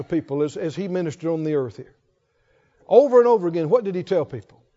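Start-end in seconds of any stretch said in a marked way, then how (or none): 2.9-4.6 fervent preaching. Acted. Preacher rousing the saints with a faith litany, fervent. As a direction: build the list like a revival preacher.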